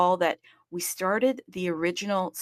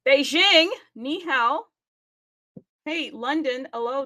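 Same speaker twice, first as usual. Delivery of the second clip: calling out greeting; 6 semitones higher than usual. Real, not acted. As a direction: shout a cheerful ni hao across the room. Universal greeting to cities.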